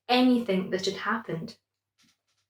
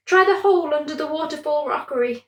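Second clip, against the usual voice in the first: squeaky prawn; 7 semitones higher, faster than usual. A narrator's reading. The other prawn advises.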